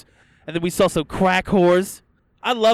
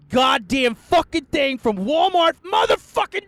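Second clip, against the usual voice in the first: building rant; 9 semitones higher, 4 dB louder than usual. Acted. Ranting at Walmart.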